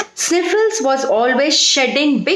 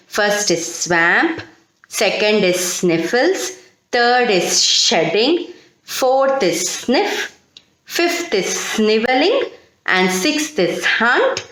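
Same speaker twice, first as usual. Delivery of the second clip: slow, deliberate dictation of the word list; slower than usual. Shown as a demonstration. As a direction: read each word slowly so students can write. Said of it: "Shall I repeat the words again?".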